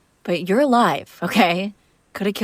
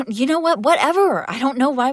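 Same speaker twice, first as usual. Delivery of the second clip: a laugh, then a high, loud exasperated "whatever"; exasperated outburst; 6 semitones higher, 4 dB louder than usual. Acted. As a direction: throw up your hands, fed up with the fight. Argument about lying, exasperated outburst.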